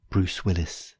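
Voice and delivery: ordinary delivery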